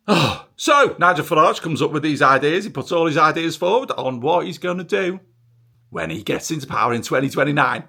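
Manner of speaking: ordinary delivery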